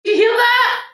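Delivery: excited. Real, not acted. Mum hears the fiver win.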